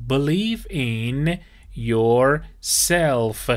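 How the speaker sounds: very slow, each word drawn out for learners; slow model phrase. Shown as a demonstration. Teacher modelling the phrase for students, very slow and clear. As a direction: say it slowly so students can repeat. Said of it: "Yourself یعنی خودت".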